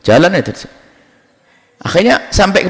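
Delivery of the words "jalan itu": ordinary delivery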